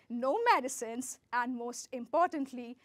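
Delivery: ordinary talk, nothing marked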